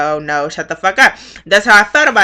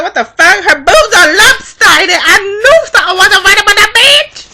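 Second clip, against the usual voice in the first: mock shocked shriek; 11 semitones higher, 5 dB louder than usual. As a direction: shriek it like you just caught her out. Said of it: "I was like".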